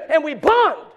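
loud, high-pitched sermon exhortation; full-force preaching. Real, not acted. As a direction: drive it home to the congregation, building to a shout. Preaching climax to congregation.

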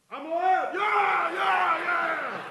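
high, whooping survivor's cheer; elated shout. Retold or imitated. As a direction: cry out high as if surfacing alive. Surfacing after the jump, acted.

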